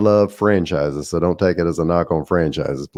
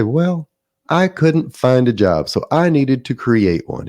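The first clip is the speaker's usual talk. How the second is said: put-on a light country accent, pitched higher; 5 semitones higher than usual. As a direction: imitate the folksy country franchise buyer, slow. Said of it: "they had a country accent".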